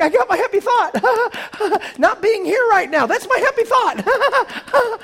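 high-pitched, drawn-out hysterical retort; mock glee. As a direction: squeal it sarcastically with manic laughs. Mock-gleeful retort of frightened Peter.

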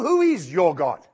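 ordinary delivery

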